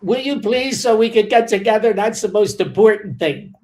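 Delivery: bursting with eagerness; excited. Real, not acted. Excited dinner plans sign-off.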